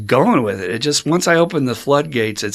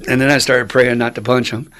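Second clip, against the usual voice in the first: ordinary talk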